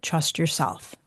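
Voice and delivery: quiet, calm and steady, as if retelling a mentor's words; softly, telling her to trust herself; calm advice. Retold or imitated. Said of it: "very calmly said".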